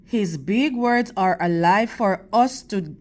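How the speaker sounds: steady, expressive storybook reading to a class; reading to children. Acted. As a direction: read the picture book to the kids, clear and measured. Teacher reads picture book aloud.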